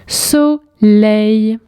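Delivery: "Soleil" drawn out as so, leil; syllable by syllable. Shown as a demonstration. Teacher correcting the syllable exercise, syllable by syllable. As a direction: sound out "Soleil" in two separate held beats. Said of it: "les syllabes où tu entends".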